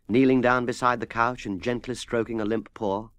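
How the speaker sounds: ordinary delivery, nothing marked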